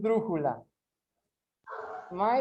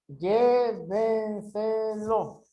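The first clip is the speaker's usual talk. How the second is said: "Llévenselo" very slow, each syllable held; much slower than usual. Shown as a demonstration. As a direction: stretch every syllable of "Llévenselo". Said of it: "separa em sílabas". ("Llévenselo" sílaba por sílaba, bem devagar)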